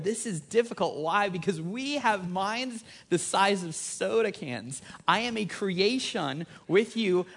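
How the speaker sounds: high, drawn-out, impassioned preaching; emphatic preaching. Real, not acted. Preacher telling the congregation why glory is difficult, in emphatic preaching. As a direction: preach it out, pitched high and stretching the big words.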